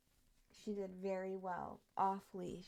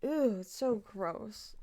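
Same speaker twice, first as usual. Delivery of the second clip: louder, slow drawn-out disgust; 9 dB louder, much slower than usual. Real, not acted. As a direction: stretch out the disgust at the taste. Disgusted by a drink.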